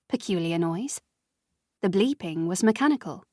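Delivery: ordinary delivery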